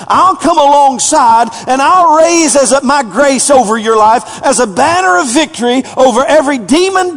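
high, loud, building proclamation; preaching at full force. Acted. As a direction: drive it home like a battle cry.

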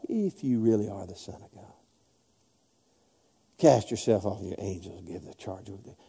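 low, hushed tempter's voice; devil's tempting. Acted. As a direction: murmur it low and soft, like a temptation. Voicing the devil tempting Jesus.

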